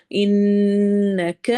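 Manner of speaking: strong nasal ghunna on انک; ghunna on nun. Shown as a demonstration. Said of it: "غنہ ہوتا ہے ناک میں آواز لے جا کر لمبا کرنا".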